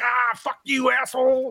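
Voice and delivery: high, shouted curse at someone; shouting. Retold or imitated. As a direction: yell it up high in a character voice. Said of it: "He's like".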